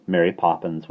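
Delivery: ordinary delivery, nothing marked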